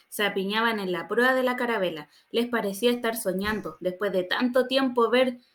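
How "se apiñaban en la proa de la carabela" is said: ordinary delivery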